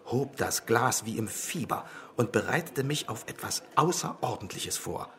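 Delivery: ordinary delivery, nothing marked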